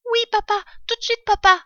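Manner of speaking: put-on very high, rapid child voice; fox cubs. Cubs obeying their father's errand, eager high voice. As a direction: answer promptly in little piping voices. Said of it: "répondirent-ils".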